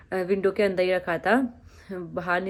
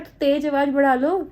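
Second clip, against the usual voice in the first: retold loud remark; 6 semitones higher, 6 dB louder than usual. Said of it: "मैंने कहा".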